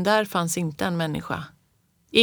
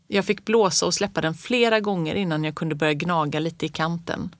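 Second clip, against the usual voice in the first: usual speaking voice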